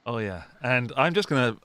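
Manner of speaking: ordinary delivery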